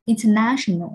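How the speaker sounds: international with NA stressed; international, main beat on the third syllable. Shown as a demonstration. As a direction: punch the NA in international. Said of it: "trọng âm sẽ rơi vào âm thứ ba".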